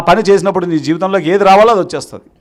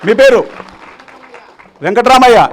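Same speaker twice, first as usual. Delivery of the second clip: ordinary delivery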